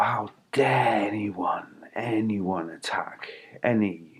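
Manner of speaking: slow, softly aghast, almost whispered; airy, drawn-out reproach; hushed indignation. Retold or imitated. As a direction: draw out "dare" in quiet disbelief. Retelling indignant thought on hearing owl.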